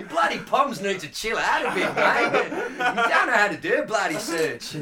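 mock Australian. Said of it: "Sorry, I'm full of shit accents today".

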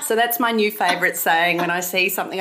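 ordinary delivery

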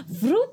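high, quick swooping sound effect; imitated whoosh. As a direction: make a high vocal whoosh. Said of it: "and she goes".

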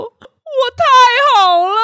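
high, wailing mock sob through the words; imitating crying friend. Friend overwhelmed by a painting, imitated crying wail. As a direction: play the friend weeping over the art, half in jest. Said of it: "然后咪咪就哭着说".

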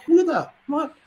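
high-pitched mock bewilderment; baffled. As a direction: act out stunned confusion, voice jumping up. Retells bafflement at strange visitor.

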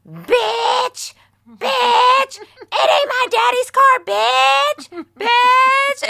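shrill furious screaming, very high and loud. Retold or imitated. Retelling a rich girl shrieking insults from her Porsche. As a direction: scream it at the top of your lungs. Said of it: "she was like".

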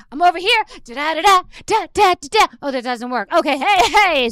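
high, loud, bouncy influencer begging for attention; mock needy influencer. Comedian mocking influencers who beg for views, high and loud. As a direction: ham up a desperate TikToker.

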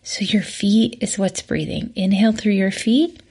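soft, whispered breathing cues with long pauses. Acted. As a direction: guide each inhale and exhale gently. Leads a breathing exercise.

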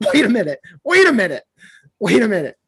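sudden excitement. Retold or imitated. Excited realisation, hair is out.

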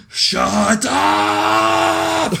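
imitating a famous freak-out, high and loud; screamed freak-out. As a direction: scream it as a hammed-up outburst. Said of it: "he just starts screaming".

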